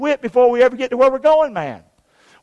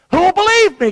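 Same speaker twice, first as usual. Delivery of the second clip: high-pitched mock protest; acted objection; 8 semitones higher, 4 dB louder than usual. Moses making excuses to God, high protest.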